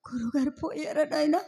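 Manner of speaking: hushed, stunned lament; starting to weep. Acted. Wife asking servant if her husband has died, soft lament. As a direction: ask it softly, as grief begins. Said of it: "ఎందుకండి ఏడుస్తున్నారు".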